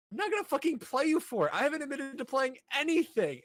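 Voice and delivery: high-pitched, lightly acted huffy denial; a hint of an outraged senior; mock indignant refusal. Acted heated refusal in retelling.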